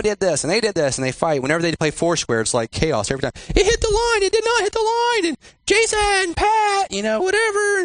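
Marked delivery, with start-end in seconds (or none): 3.5-7.8 put-on high-pitched voices of children arguing over a game; squabbling kids. Preacher mimics children fighting over Foursquare, high squabbling voice. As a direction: whine it out high like a disputing kid.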